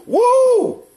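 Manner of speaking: sudden high falsetto whoop; mock whoop, way up high. Real, not acted. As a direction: let out a shrill woo. Mock cheer after insult.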